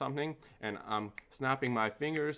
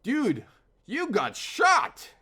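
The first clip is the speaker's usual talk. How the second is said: drawn-out, high, hammed-up shout; mock-alarmed yell to an injured friend; retold alarm shout; 12 semitones higher, 10 dB louder, much slower than usual. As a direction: imitate breaking shocking news, very loud and slow. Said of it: "without a second of hesitation".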